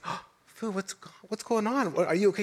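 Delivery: hushed, worried check-in; concerned. Retold or imitated. Husband gently asking his weeping wife on the trail, hushed and worried. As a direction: softly ask what's wrong.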